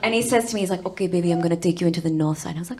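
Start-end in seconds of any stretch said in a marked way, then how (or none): 0.9-2.5 quick retelling; boyfriend's line again, rushed; fast. Retold or imitated. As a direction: rattle through it. Imitating Stavros again.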